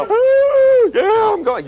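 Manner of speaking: high, loud excited whoop; eager whoop. Retold or imitated. The worker answering the voice, whooping. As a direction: leap up eagerly. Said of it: "You know".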